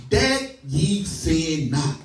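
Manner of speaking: very slow, drawn out word by word; deliberate, spaced-out scripture repeat; slow emphatic repeat. Real, not acted. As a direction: stretch each word for weight. Verse repeated slowly for emphasis.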